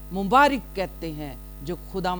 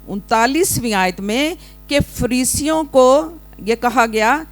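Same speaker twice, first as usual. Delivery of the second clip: loud, raised preacher's voice; full-force preaching; 7 semitones higher, 10 dB louder than usual. Real, not acted. Preacher asking the congregation to read the verse, loud preaching. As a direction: ring it out to the congregation.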